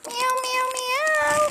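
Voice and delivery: high, mewing cat sounds; imitated meows. Coaxing the cat with high, mewing meows. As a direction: mew high like a kitten.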